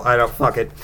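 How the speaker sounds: normal talking voice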